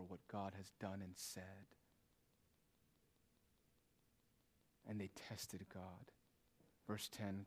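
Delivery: low, soft sermon voice with weighty pauses; hushed, solemn preaching. Real, not acted. Preacher warns churchgoers of God's wrath, hushed solemn preaching. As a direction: bring it right down, gravely warning.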